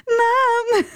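put-on a high, loud toddler's call; child calling Mum.

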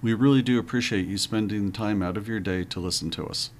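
ordinary talk

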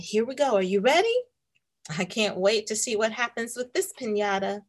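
ordinary delivery

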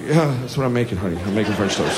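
low, soft, worn-out reply; weary patient dad. Acted. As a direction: answer a screaming child very quietly. Weary dad answers screaming child.

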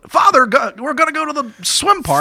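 put-on high, bright child calling out; excited kid voice. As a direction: chirp it like an eager kid. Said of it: "You can't just be like".

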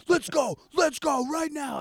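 high-pitched imitation of a mad guy demanding a fight; mad, retold. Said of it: "he was so mad".

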